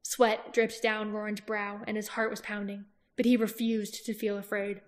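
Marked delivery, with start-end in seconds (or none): none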